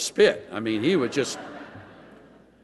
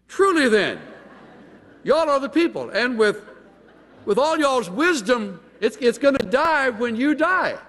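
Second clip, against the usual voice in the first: sarcastic y'all; 10 semitones higher than usual. Retold or imitated. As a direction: lay the sarcasm on thick, Southern. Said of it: "having learned a little sarcasm from his friends".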